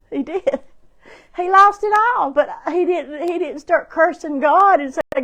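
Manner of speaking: high, loud preaching; preaching at full force. Acted. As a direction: preach it out with fervor. Preaching builds up.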